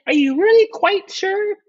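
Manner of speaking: high-pitched, insistent wife; doubting wife. Acted. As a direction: question him in a piping wife's voice. Wife questions Taft.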